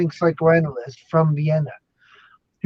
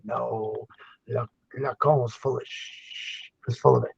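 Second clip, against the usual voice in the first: low, soft, slow Freud verdict; low-pitched, drawn-out mock pronouncement; voicing a Freud doll; 5 semitones lower, 8 dB quieter, slower than usual. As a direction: answer as Freud, slowly, low and hushed. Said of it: "And Freud would literally go".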